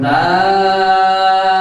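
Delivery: দাল sung long and loud, vowel held; letter Dal drawn out. Shown as a demonstration. Teacher recites দাল for the class to repeat. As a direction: chant দাল slowly, tongue tip on the teeth. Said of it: "আপনারা সবাই আমার সাথে বলুন".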